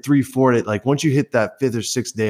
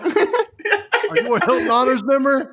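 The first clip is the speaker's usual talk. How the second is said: high, slow laughing greeter voice; mock the scripted welcome, high; corporate script parody; slower than usual.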